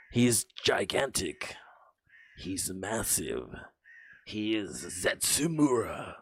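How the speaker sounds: slow, drawn-out raspy hype voice; whispery, slow mock-epic announcement; dramatic monster announcer. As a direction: stretch each word like introducing a giant monster. Playful dramatic announcement of a character.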